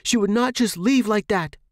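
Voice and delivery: high, loud and fast demand. Acted. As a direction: insist on an answer, pushing hard. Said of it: "I raised my voice".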